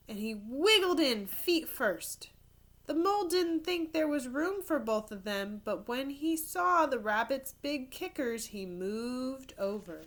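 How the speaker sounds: put-on lively storybook narration for kids; animated read-aloud; reading to children. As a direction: tell it like a picture book at story time. Reads picture book aloud.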